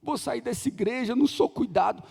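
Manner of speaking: mocking complaining member. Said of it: "tem gente que fala".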